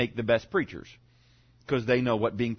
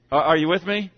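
ordinary talk, nothing marked

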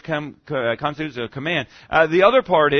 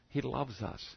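hushed echo; 4 semitones lower, 15 dB quieter than usual. Acted.

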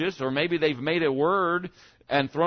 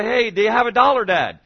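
put-on begging child; 6 semitones higher, 8 dB louder than usual. Child asking parent for money.

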